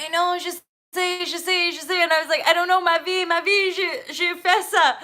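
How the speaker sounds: flustered high reply. Retold or imitated. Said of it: "And I'm like".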